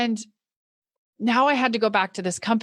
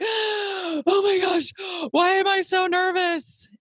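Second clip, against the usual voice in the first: high, slow, stretched panicked exclamation; half-shouted alarm at herself; panicked inner cry; 9 semitones higher, slower than usual. Retold or imitated. Nervous speaker realizing her mic was on, panicked. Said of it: "And I was like".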